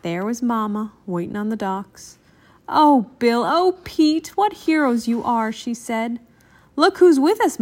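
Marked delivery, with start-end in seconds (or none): 2.7-5.5 raised, emotional welcome; mildly gushing mother; delighted mama. A narrator's reading. Mama greets returning heroes. 6.8-7.6 high, loud announcement; eager, high-pitched child; excited reveal. A narrator's reading. Bill reveals Papa to Mama.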